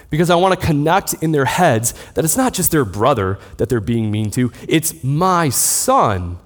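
normal talking voice

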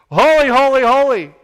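high, loud chant-like shout; calling out. Acted. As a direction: fire it across like a stadium call. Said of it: "calling back and forth to each other".

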